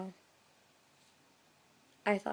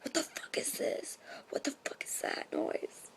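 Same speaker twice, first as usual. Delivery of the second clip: frightened hushed whisper; scared whisper; 7 semitones higher, 4 dB quieter than usual. Retold or imitated. Alone at night hearing booms, scared whisper. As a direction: whisper it, half asleep and spooked. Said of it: "I was like".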